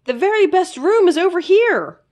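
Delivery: high, bright-eyed little critter voice; high eager sidekick calling a friend; Zeke the little creature. A narrator's reading. Zeke's voice in the story.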